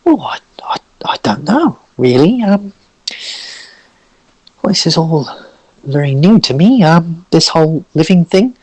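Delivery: high, halting character voice; hesitant, bewildered Orson. Acted. Orson asked what he'll do now, unsure and high. Game master plays Orson.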